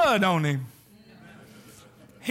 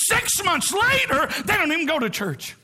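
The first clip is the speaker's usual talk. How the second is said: ordinary talk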